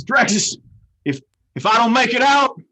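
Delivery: loud, high, dramatic farewell; heroic last words. Acted. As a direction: deliver a doomed hero's final plea.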